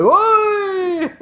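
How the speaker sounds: high, loud, long holler; imitated sparring yell. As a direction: holler it like cheering a sparring sweep. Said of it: "that's what everybody's yelling".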